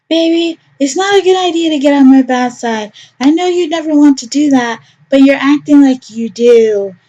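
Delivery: doting mom with a veiled warning; sweetly menacing mother. Acted. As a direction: smile while you threaten. Mother threatens gently.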